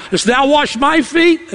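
slow and incredulous, climbing through "my feet"; emphatic disbelieving question. Shown as a demonstration. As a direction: lift "feet" high in disbelief. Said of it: "emphasis on I and thou".

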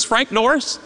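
ordinary talk, nothing marked

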